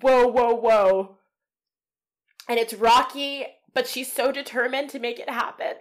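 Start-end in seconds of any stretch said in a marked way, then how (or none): 0.0-1.2 crowd awe. Retold or imitated. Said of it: "everybody's like".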